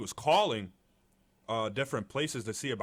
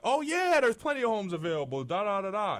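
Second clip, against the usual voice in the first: much higher, bright and eager, like a helpful landlord on the phone; cheerful agent voice; 10 semitones higher, 6 dB louder than usual. Retold or imitated. Landlord on the phone to the neutral caller, eager and much higher. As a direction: play the friendly agent who suddenly has homes. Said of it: "So they're like".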